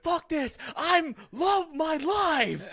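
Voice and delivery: very high, strained shouting; mock rage quit. Player snapping on hardest mode, mock raging shout. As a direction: drag out a hammed-up scream. Acts out gamer snapping under anxiety.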